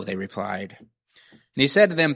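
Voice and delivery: ordinary talk, nothing marked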